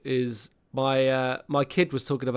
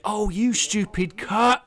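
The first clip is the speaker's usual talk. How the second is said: retold pained curse; 8 semitones higher, slower than usual. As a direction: act out cursing at a dropped weight on your ankle. Retold swearing after hurting ankle.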